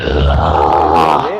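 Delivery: very low, slow ghostly groan; drawn-out ghost moan. Acted. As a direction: stretch it like a moaning spirit. (धीमी लंबी भुतहा कराह)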